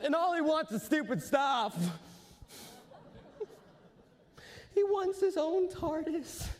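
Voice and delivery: high-pitched, stammering nerd tantrum; geek, impatient and demanding. Acted. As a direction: whine for it like an obsessed fan, pitch way up.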